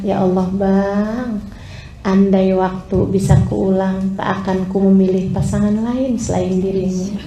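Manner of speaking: low, soft words to a husband; tender wife. Acted. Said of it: "kamu pandangi suamimu, kemudian kamu bilang". (istri berbisik mesra dengan lembut)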